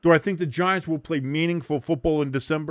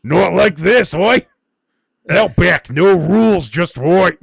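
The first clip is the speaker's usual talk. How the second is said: loud, high bad Australian, slowing at the end; a parody seductive Aussie voice; mock Aussie ASMR; 5 semitones higher, 10 dB louder, slower than usual. Host mocking a chat request for ASMR, loud fake Australian. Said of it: "do the A S M R videos".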